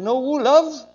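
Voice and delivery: high, loud mock puzzlement; puzzled reply. Man on bus answers the little lady, loud puzzled question.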